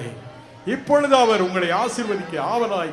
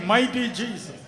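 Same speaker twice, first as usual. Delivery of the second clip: ordinary delivery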